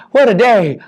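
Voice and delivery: plain reading voice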